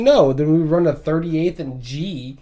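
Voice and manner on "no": loud high flat refusal; defiant no. Retold or imitated. Kids refusing the bus driver, loud high defiant no. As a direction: refuse cheekily. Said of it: "we're like".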